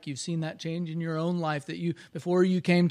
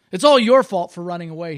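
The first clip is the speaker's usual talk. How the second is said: grumbling; 10 dB louder than usual. Acted. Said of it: "he grumbled".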